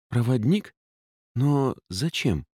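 puzzled and hesitant; confused. Acted. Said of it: "спросила смущённо Эллен".

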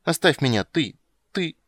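put-on high, petulant little-girl protest; upset child. A narrator's reading. Caught girl tells witcher to let her be, upset.

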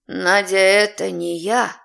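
plain reading voice

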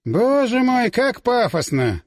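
high start, then slow drawn-out scorn; mocking exclamation. A narrator's reading. Onlooker mocking a pompous summoning, derisive. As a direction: deride the pomposity, stretching the words. Sokolov mocks the pompous ritual.

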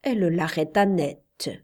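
ordinary delivery, nothing marked

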